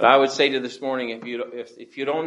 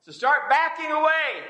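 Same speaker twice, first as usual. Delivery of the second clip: impassioned preaching; 9 semitones higher than usual. Acted. Preacher reading the king's orders to Joab, impassioned and high-pitched. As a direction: build from raised, higher pitch to high, ringing preaching.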